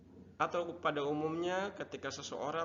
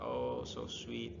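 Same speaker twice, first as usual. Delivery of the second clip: touched reply; 12 semitones lower, much slower than usual. Shown as a demonstration. Teacher models touched reply.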